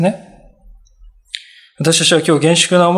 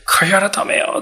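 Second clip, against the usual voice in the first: a call to repent; 2 semitones higher than usual. Retold or imitated. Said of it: "って言って、言ったんですよね".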